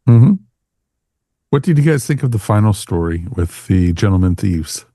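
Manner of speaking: ordinary talk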